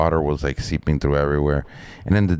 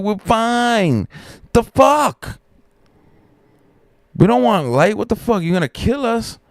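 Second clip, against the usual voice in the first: high, outraged hollering; retold yelling. Basement crew telling the worker to stop, high outraged yelling. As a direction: yell back in exasperated disbelief. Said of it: "we were all yelling".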